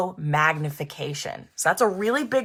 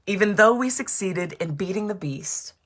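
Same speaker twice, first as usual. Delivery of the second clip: usual speaking voice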